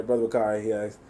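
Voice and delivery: usual speaking voice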